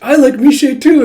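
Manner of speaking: put-on high, loud gushing girl voice; excited girl. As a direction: blurt it out with sudden glee. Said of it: "It's like".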